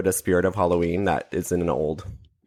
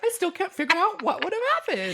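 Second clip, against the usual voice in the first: very high, laughing imitation; squeaky hammed-up puzzlement through laughter; mock bafflement. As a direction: play clueless rescuers while cracking up. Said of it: "And they're like".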